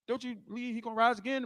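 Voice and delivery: soft, calm retelling. Acted. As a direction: speak quietly and calmly to her. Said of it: "He's calm at, with her".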